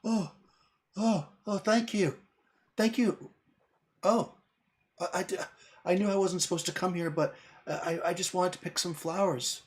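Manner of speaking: put-on high, small voice of a scared little girl, thanking her rescuer; frightened fey girl. GM voicing a frightened fey girl to Adrian, high and thankful. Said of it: "and she says".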